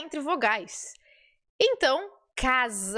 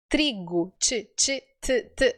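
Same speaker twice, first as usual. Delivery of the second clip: normal talking voice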